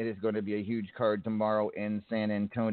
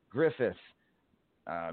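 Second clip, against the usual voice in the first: ordinary talk, nothing marked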